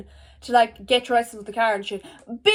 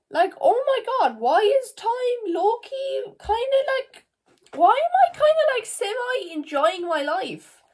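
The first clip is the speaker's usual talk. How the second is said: amazed; 9 semitones higher than usual. Real, not acted. Amazed she enjoys life.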